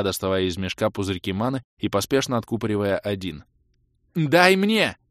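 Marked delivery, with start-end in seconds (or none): 4.1-5.0 high, loud female caster's cry; shouted demand. A narrator's reading. Spellcaster demands mana mid-fight, shouted. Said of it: "крикнула продолжающая магичить Кэлен".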